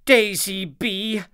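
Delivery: high, stern queen bee voice; a queen bee telling off a young bee; queen bee scolding. A narrator's reading. Queen bee correcting Daisy's dance, stern and high. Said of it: "said the Queen".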